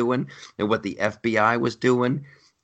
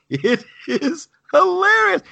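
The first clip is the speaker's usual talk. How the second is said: high, loud delight; 5 dB louder than usual. Real, not acted. Gushing to her co-host about the show, high delighted squeal. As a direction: shriek it is hilarious with delight.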